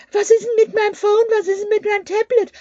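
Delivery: high, whiny imitation of someone begging for tech help; mock clueless user. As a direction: ape a helpless person asking about their phone. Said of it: "wenn dich einer fragen tut". (nachgeäffte hohe, quengelige Stimme)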